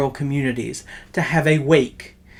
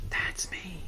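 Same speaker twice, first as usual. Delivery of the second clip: soft breathy whisper; whispered aside; 4 semitones higher, 17 dB quieter than usual. Acted. Host owning up to his own praise, whispered. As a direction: let it slip out as a hushed secret.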